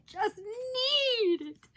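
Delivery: high, drawn-out yearning; swooning, slow want; dramatic longing. Real, not acted. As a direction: stretch it out, desperate to have it. Gushing over wanted ears.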